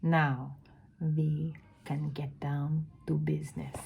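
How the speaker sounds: put-on dropping to a hushed witch accent; brisk turn to business, whispered in the accent; Grand High Witch accent. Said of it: "do the accent".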